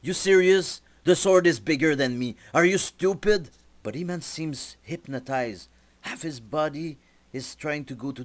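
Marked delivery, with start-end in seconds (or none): 0.0-3.5 higher, louder, exasperated disbelief; annoyed. Acted. Jazz snaps back at the sword's guardian, annoyed and incredulous. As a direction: scoff at the entity like it's an idiot. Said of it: "Jazz gets annoyed".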